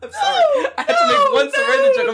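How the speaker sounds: very high, squeaky through laughter; helpless laughter. Real, not acted. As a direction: apologise while cracking up.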